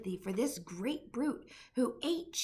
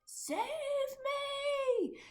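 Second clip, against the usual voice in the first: terrified scream. A narrator's reading. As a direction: shriek for help in panic. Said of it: "screamed the flesh lump eater".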